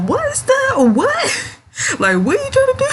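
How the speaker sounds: baffled disbelief. Pretend. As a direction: shriek it as if you can't believe what you heard.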